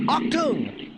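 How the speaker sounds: hammed-up German shout; mock German command. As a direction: bark it like a cartoon German officer. Mocking Adidas as Third Reich brand.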